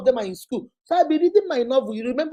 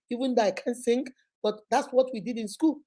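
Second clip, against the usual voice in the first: usual speaking voice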